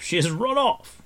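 high, loud, bitter exasperation. A narrator's reading. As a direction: throw it out bitterly. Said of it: "added with a bitter laugh".

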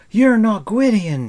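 high, loud accusing shout. A narrator's reading. Taran challenging the stranger he mistook for Gwydion, shouting. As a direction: shout it at full pitch. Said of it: "he shouted".

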